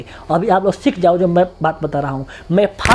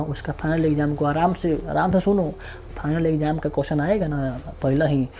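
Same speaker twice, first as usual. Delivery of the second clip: calm reassurance; 6 semitones lower, 9 dB quieter than usual. Real, not acted. Teacher calming exam-worried students.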